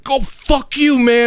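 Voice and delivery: high, mock angry yell; furious pedestrian. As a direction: spit it out at the apologizing driver.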